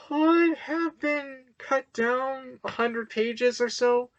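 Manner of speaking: COULD stretched out, high pitch held; slow, high-pitched concession on could; drawn-out COULD. Real, not acted. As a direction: lean long on could, voice up high. Conceding point with stretched, raised COULD.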